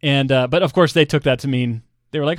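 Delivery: ordinary talk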